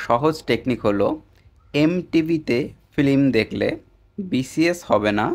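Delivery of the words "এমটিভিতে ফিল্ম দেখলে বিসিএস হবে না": slow, deliberate "এমটিভিতে ফিল্ম দেখলে বিসিএস হবে না" with each word set apart; slow mnemonic. Shown as a demonstration. Teacher giving students a memory trick, slow and deliberate. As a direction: lay out the memory trick slowly. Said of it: "এই কথাটা একবার বুঝুন".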